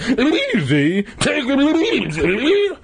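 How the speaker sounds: very high, slow, hammed-up shriek. A narrator's reading. Mister McCall throwing Dad's words back at him, shrieking. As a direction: screech it back in outrage, stretching the words. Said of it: "Mister McCall shrieked".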